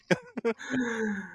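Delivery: short high laughter trailing off; real laugh fading out. Real, not acted. Host laughing again.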